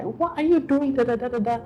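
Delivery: high-pitched mock scolding from a sister; imitated scolding. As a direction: retell a telling-off, trailing into da-da-da filler. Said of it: "She started scolding me".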